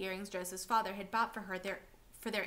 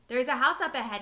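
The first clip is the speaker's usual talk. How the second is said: loud call over the shoulder while running; yelling; 3 semitones higher, 10 dB louder than usual. Acted. Mother fleeing soldiers, yelling to her son. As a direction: shout it ahead to your son. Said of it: "his mother yelled over her shoulder".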